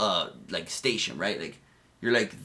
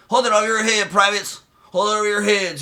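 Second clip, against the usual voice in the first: higher, louder mock drill sergeant yell; 8 semitones higher, 5 dB louder than usual. Recruit imitating sergeants yelling at new privates, mock yell. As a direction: bellow it at the privates. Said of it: "They're yelling at you".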